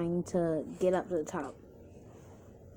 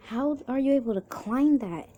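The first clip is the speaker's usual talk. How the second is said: raised, higher voice calling up in disbelief; yelled question; 7 semitones higher, 6 dB louder than usual. A narrator's reading. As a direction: yell up from the bottom. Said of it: "You yell from the bottom".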